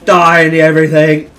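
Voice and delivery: high, loud attacking shout; battle yell. Real, not acted. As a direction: shout it as you go for the kill. Yelling at opponents in game.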